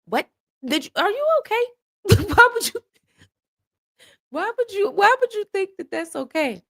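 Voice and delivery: high-pitched, laughing disbelief; squeaky baffled protest; incredulous. Acted. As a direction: demand an explanation in sheer disbelief. Baffled reaction to friend's suggestion.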